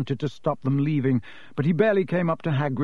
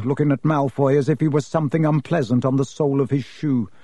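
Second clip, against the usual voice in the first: ordinary delivery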